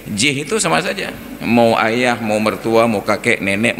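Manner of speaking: normal talking voice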